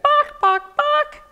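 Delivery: high-pitched, loud hen squawks; chicken clucking. Acted. Storyteller leading children in chicken sounds, squawking. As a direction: cluck along sharply like a chicken. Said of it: "That's what chickens say".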